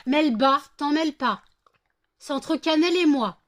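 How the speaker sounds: high, loud shout; shouting at the dog. Real, not acted. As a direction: yell at the dog to back off. Crie après Melba. (crier fort sur la chienne)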